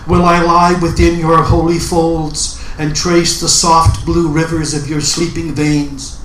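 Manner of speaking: normal talking voice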